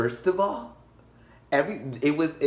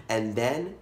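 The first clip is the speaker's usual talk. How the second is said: ordinary talk, nothing marked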